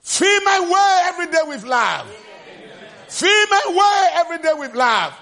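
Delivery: loud, high preaching refrain; preaching at full force. Acted. Preacher calling the church to love, loud. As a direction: proclaim it to the congregation.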